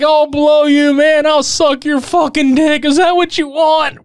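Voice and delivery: high, pleading yell; desperate begging. Retold or imitated. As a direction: beg for your life, shouting. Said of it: "He's like".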